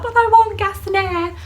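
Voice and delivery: put-on high, loud childlike-insistent whine; wailed protest. Patient refused gas and air, loud high wailed protest. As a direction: act out refusing to take no.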